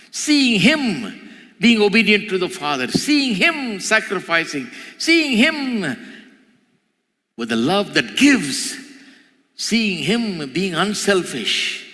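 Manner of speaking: high, drawn-out pulpit refrain; impassioned, stretched-out sermon cry; fervent preaching. Acted. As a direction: ring out each phrase like a preacher's refrain.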